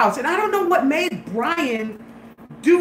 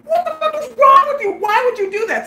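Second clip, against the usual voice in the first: high, loud retold rebuke; exasperated scolding; 13 semitones higher, 4 dB louder than usual. Storyteller berates the joker, high exasperated scolding. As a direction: demand an explanation, appalled.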